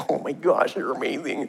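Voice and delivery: soft, tender, melting; quiet doting father cooing; melting dad. Retold or imitated. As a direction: coo at your little boy. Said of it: "you're just like".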